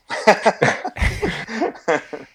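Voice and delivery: high laugh at life's irony; amused laugh. Real, not acted. Guest laughs ending his anecdote. (rire amusé et aigu)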